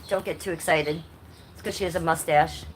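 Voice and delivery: ordinary talk, nothing marked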